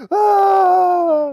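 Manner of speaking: high loud imitated wail; child's crying wail. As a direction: let out a little boy's sobbing cry. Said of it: "Why are you crying?".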